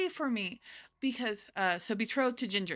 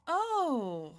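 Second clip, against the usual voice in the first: high, simpering fake-victim voice; scheming villainess; 7 semitones higher than usual. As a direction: bat your eyelashes and play the victim. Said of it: "she's like".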